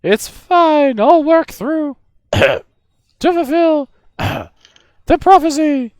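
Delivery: high-pitched spirit voice; high ghostly voice. Acted. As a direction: call out like a ghost from beyond. Spirit coughing through.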